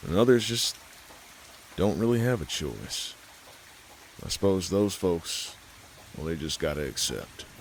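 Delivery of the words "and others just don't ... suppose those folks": plain reading voice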